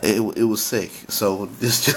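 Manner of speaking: ordinary talk, nothing marked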